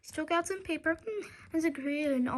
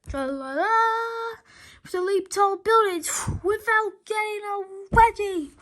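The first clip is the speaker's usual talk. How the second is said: high, louder, stretched superhero call; heroic cry; 5 semitones higher, 4 dB louder than usual. Acted. Hero leaping into battle, high triumphant call. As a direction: sing it out triumphantly.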